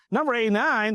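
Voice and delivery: high, scornful disbelief at Number eighty-nine; incredulous repeat. Retold or imitated. Big John scoffing at the request for number eighty-nine, high incredulous. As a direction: repeat it like it's a ridiculous ask.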